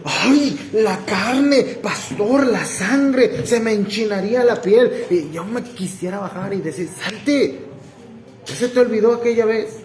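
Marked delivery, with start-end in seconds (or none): 0.0-5.1 high-pitched, hammed-up gut reaction; mock indignant outcry. Voices a congregant's indignant reaction. 6.9-9.8 high-pitched, playful indignant challenge; mock angry ejection. Imagines throwing the man out.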